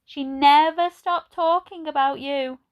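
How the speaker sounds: put-on high, slow kindly old lady. A narrator's reading. Narrator voices old Edna greeting Ben, high and slow. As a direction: voice her like a gentle elderly lady. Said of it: "said Edna".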